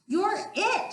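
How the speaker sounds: high squeaky shrimp voice; small shrimp shouting in play; shrimp's shout. Acted. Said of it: "shouted a shrimp".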